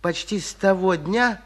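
ordinary delivery

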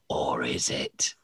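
breathy teasing whisper. Real, not acted. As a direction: whisper it like a spooky secret. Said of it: "teasing whisper to listeners".

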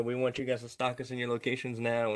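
ordinary talk, nothing marked

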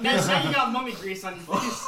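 laughing. Real, not acted. Mummy hits him with grease.

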